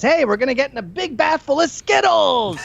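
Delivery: put-on high, excited kid-YouTuber pitch; hyper YouTuber voice. Mocking the son's favorite YouTuber, Guava Juice, in a hyped voice. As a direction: sell it like a kids' channel host. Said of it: "Guava Juice talks like this".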